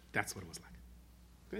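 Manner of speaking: slow, breathless low whisper; a king hushing his answer to the trembling subject; hushed king. Retold or imitated. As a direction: whisper it slowly, letting it sink in. King reveals the lesson softly.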